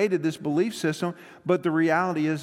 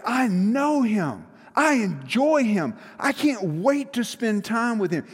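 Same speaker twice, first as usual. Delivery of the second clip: Buddy's joyful eagerness; 5 semitones higher, 3 dB louder than usual. Retold or imitated. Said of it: "you know what Buddy does".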